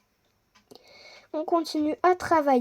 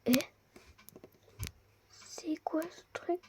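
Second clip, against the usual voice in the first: whisper; 3 semitones higher, 13 dB quieter than usual. Real, not acted. Player whispers over a strange find. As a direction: hush it in wonder.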